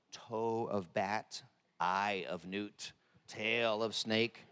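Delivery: very slow, low spell-casting recital; witch incantation. Pretend. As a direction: intone it like a witch's recipe.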